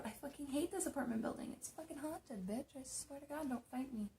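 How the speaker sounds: high, very soft little voice; small, high-pitched near-whisper; hushed pleading aside. Pretend. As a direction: keep it down so the ghosts don't hear. Hushed aside about the haunted building.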